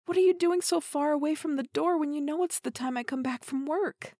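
ordinary talk

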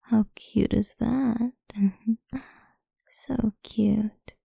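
ordinary talk

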